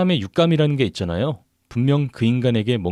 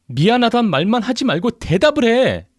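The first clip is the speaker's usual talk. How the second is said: high, raised-voice angry demand; uncontrollable anger; 11 semitones higher, 4 dB louder than usual. A narrator's reading. Wife demanding an answer from husband, furious. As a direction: demand an answer, losing your temper. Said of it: "순간적으로 화를 주체할 수 없었던".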